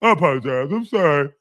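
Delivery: mocking put-on wimpy apology; mock groveling in an altered little voice; weenie-dog apologizing. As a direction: repeat the apology like a cowering weenie. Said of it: "keep apologizing like a weenie dog".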